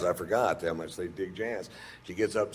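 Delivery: ordinary talk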